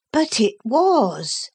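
put-on firm, insisting boy. A narrator's reading. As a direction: press the point gently. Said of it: "Philip insisted".

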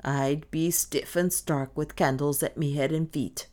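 ordinary delivery, nothing marked

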